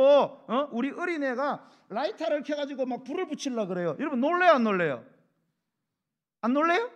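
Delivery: loud, high fervent preaching; preaching at full force. Acted. Preacher stirring the congregation, loud and high.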